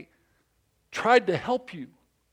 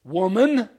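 voice of God; 6 semitones higher, 7 dB louder, much slower than usual. Acted. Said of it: "it's God saying".

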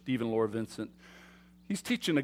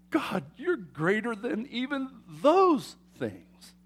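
higher, slow, hushed reverence; reverent praise; 6 semitones higher, slower than usual. Acted. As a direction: speak it up to God in awe. Voicing a worshipper's praise.

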